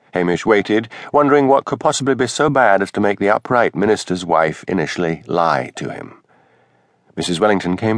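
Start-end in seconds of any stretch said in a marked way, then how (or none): none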